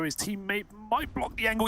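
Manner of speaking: ordinary talk, nothing marked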